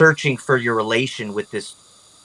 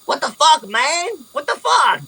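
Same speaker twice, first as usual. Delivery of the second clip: very high, squawky goblin protest; startled goblin; 14 semitones higher than usual. Acted. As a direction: complain as an outraged little goblin, pitched way up. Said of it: "one of the goblins".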